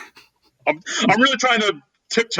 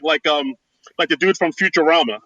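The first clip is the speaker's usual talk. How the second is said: usual speaking voice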